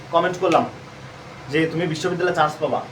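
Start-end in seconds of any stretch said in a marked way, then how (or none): none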